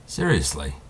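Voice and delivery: ordinary talk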